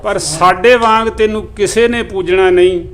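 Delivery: measured, even recital of verse; poem recitation. Acted. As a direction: recite each line like a mushaira couplet. Recites Punjabi poem to audience.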